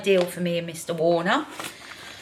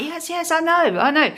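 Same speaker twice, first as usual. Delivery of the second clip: exasperated; 8 semitones higher than usual. Retold or imitated. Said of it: "And I'm like".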